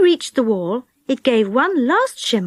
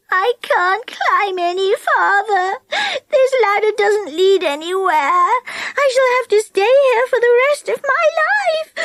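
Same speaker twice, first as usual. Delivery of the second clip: miserable sobbing; 10 semitones higher than usual. A narrator's reading. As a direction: wail like a child in despair. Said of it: "she sobbed".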